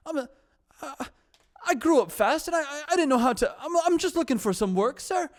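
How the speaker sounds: very high, loud Irish character voice; put-on Irish accent. Shown as a demonstration. Film character pleads for work, in a high put-on Irish accent. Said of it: "that's the character of".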